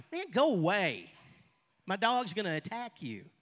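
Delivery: mock-exasperated retelling; acted irritated warning.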